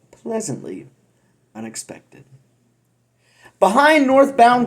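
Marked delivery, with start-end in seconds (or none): 0.1-2.2 breathy dramatic whisper; hushed teasing whisper. Pretend. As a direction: drop to a whisper for effect. Dramatic hushed ending of the ad copy.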